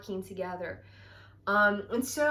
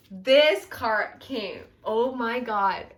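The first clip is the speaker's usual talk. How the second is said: slow, louder awestruck reaction to the card; amazed gasp; 2 semitones higher, 8 dB louder, slower than usual. Real, not acted. Reader awed as the card turns up, loud and slow. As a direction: let the card stun you, drawn out.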